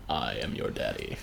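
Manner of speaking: low, soft Vader voice; deep, hushed fake Vader; hammed-up low Vader; mock Vader. Joking Vader line.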